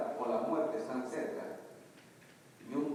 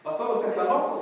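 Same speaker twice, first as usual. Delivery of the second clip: exasperated outburst; 10 semitones higher, 9 dB louder than usual. Retold or imitated. Wife scolds the pastor.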